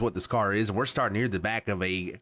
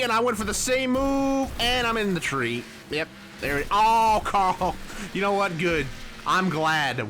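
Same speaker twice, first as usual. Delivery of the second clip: rising frustration; 7 semitones higher than usual. Real, not acted. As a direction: yelp as the car hits. Crashes into the tree.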